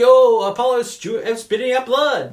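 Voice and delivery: high, loud ringside call; excited fight commentator. Retold or imitated. Movie fan mimics the commentator calling the fight, high and loud. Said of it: "the commentator, Stu Nathan".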